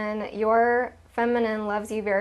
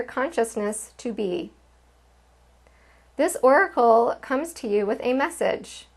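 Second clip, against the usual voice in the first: measured, earnest reading of a card's message; oracle card reading; 4 dB louder than usual. Acted. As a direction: read the oracle's words like a gentle pronouncement. Reads oracle card message aloud.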